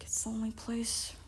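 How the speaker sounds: soft, breath-only whisper; Percy's hushed reply. Retold or imitated. Recapper voices Percy answering Grover, whispering. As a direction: whisper it, barely audible. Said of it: "Percy's like".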